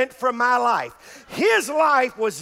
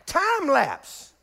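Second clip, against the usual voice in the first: normal talking voice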